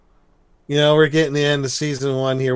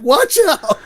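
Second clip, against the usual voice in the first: shouted warning; 10 dB louder than usual. Retold or imitated. Hank warning in cartoon retelling.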